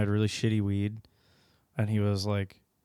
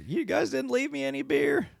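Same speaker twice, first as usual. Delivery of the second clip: dead grandpa complaining. Retold or imitated. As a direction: whine it from inside the coffin in a silly squeak. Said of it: "He's like".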